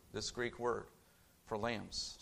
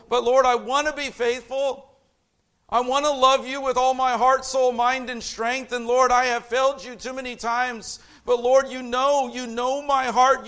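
raised, pleading cry to the Lord; anguished plea, louder and higher; impassioned confession; 9 semitones higher, 10 dB louder than usual. Acted. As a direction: pour out the confession, loud and higher. Peter voiced confessing to Lord.

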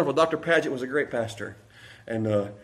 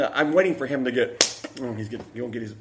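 usual speaking voice

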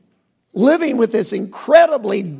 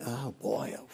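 put-on softer groaning oh boy; mock dread; 9 semitones lower, 15 dB quieter than usual. Bored churchgoer dreading a long service, soft groan.

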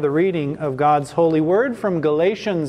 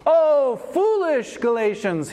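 high OH, then falling through FOOLISH GALATIANS; very emphatic; 6 semitones higher than usual. Acted. Apostle rebuking the Galatians, very emphatic. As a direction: exclaim it as a heartfelt rebuke. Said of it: "This is a very emphatic statement".

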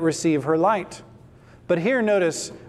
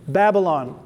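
normal talking voice

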